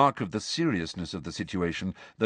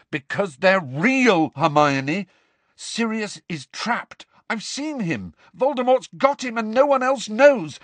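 high-pitched, heated tirade; raised, insistent, exasperated voice; angry shouted rant; 12 semitones higher, 4 dB louder than usual. A narrator's reading. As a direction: rant in mounting frustration. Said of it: "Harry shouted at her".

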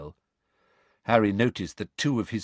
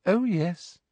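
soft Umbridge voice; 10 semitones higher than usual. A narrator's reading. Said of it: "said Umbridge softly".

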